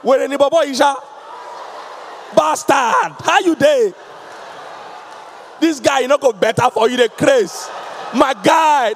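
loud rowdy street greeting; insulting greeting. Retold or imitated. Friends greeting with insults, loud banter. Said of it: "use insult to greet themselves".

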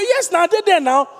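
high, loud put-on voice; voicing a friend. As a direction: answer like the friend on the couch. Pastor voices the listener friend.

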